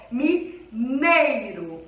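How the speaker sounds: mineiro said very slowly, the ei gliding as one sound; slow demo. Shown as a demonstration. Teacher slowly modelling mineiro for students. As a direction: stretch out mineiro so the weak i shows. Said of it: "Ele perde o som pro E".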